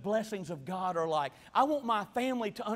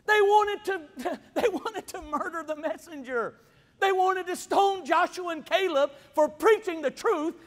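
incredulous outburst; 6 semitones higher, 5 dB louder than usual. Real, not acted. Preacher telling the church they wanted to stone the messengers, incredulous. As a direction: blurt it out in disbelief, laughing.

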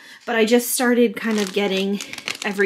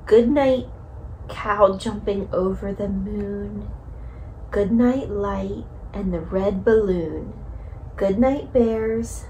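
slow, sing-song bedtime reading; reading to a toddler; slower than usual. Acted. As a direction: read it gently to a little one. Reads Goodnight Moon to toddler.

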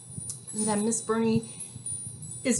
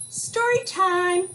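higher, louder, slow mama call; 9 semitones higher, 7 dB louder, slower than usual. Acted. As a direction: call the child in from across the meadow. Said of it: "heard Mama call".

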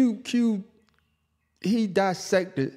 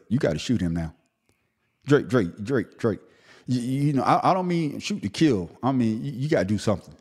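usual speaking voice

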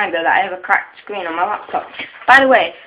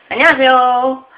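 loud bright Korean greeting; 5 semitones higher, 8 dB louder, much faster than usual. Pretend.

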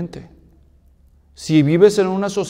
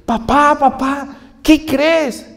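put-on high, eager child calling his dad; a small boy bursting with news for his papá; excited little boy; 7 semitones higher, much slower than usual. Niño emocionado llamando a su papá.

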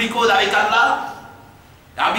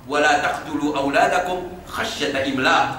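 Quran recitation; 6 semitones lower, much faster than usual. Acted. Recites Quran verse fast.

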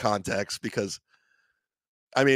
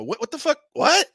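higher, rising what the fuck; shocked, climbing into a squeal; shocked disbelief; 13 semitones higher than usual. Retold or imitated. Confused shouting at security.

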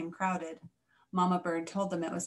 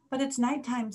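higher, small protesting voice; lightly voiced squeaky objection; little squeak; 5 semitones higher than usual. A narrator's reading. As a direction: squeak it like a worried youngster. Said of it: "Stella Luna squeaked".

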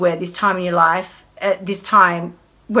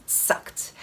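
whispered; 12 dB quieter than usual. Real, not acted. Whispered aside to the viewers about the diet. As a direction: repeat it as a whisper.

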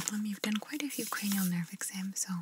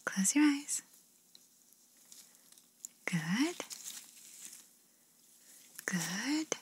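ASMR whisper; 3 semitones higher, much slower than usual. Acted. ASMR artist whispering instructions to the listener. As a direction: breathe the words gently, no voice.

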